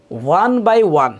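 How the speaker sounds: slow, deliberate word-by-word delivery; spaced out step by step; one by one. Shown as a demonstration. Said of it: "এইভাবে try করবেন".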